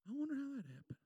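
a light hint of a puzzled grandpa. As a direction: wonder aloud softly like an old man noticing damage. Grandfather voiced in story.

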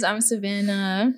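usual speaking voice

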